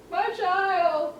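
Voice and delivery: high mock-tearful wail; retold tearful wail. Mother retelling her tears over her child, high imitated wail. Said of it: "I'm in tears".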